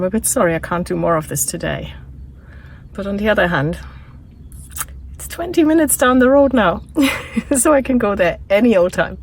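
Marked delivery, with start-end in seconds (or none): 5.1-9.2 laughing. Real, not acted. Laughing at own joke.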